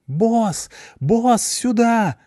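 high-pitched slow yell of a little creature; shrill-voiced cartoon mop calling out. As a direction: squeal for the boss to come. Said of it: "завопила из зала швабра".

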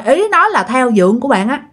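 ordinary talk, nothing marked

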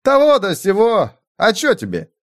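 high, cheeky soldier voice; a rude dodging commoner; evasive cook. Acted.

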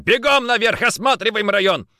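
high, loud command; shouting orders. Acted. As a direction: send them running. Ordering men to run. (громкая команда)

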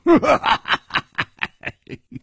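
high, bright teasing laugh; hearty laugh. Acted. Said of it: "笑着说".

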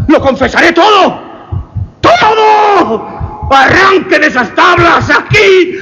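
high, shrill, desperate shouting; the murderer screaming his guilt at the policemen; frantic screaming confession. A narrator's reading. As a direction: scream it out, breaking down completely. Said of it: "Era necesario gritar".

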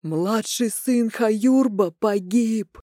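slow, high, grieving shout; mournful cry. Acted. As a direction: cry out the loss slowly. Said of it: "подхватил горестный крик". (горестный медленный крик)

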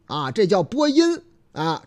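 usual speaking voice

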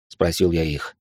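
usual speaking voice

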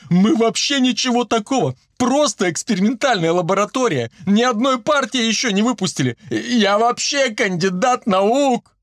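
high pleading excuses; frightened squealing. A narrator's reading. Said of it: "снова взвизгнул химик, поджимая ноги от страха". (испуганно, визгливо оправдываясь)

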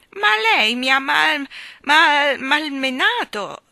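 put-on high, slow, stammering child's protest; hurt boy stuttering out a complaint. A narrator's reading. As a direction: stumble over the word like a wounded little boy.

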